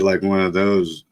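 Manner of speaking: ordinary delivery, nothing marked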